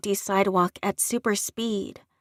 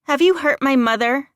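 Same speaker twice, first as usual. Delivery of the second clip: loud, higher, forceful demand; 4 semitones higher, 7 dB louder than usual. A narrator's reading. Daughter confronts strangers about her mother, demanding. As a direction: demand an answer with full authority. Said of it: "I demanded, power still coating my voice".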